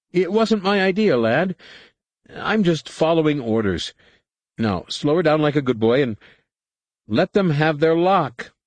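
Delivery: high-pitched co-pilot excusing himself; treacherous co-pilot. A narrator's reading. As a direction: coax the lad to obey in a high voice.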